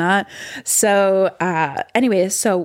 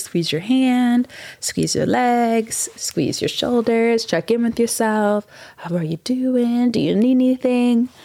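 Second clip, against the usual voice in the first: gentle guided check-in; 4 semitones higher than usual. Acted. To viewers, easing them through a calm self check-in.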